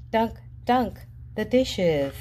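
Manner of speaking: ordinary delivery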